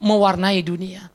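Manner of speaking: high, loud preacher's cry; full-force preaching. Acted. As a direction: proclaim it to the whole church, drawing out key words. (khotbah berapi-api, lantang)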